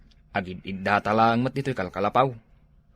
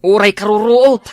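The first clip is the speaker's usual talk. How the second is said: loud shout; 9 semitones higher, 10 dB louder than usual. Acted. Friend shouting lazily about weeds.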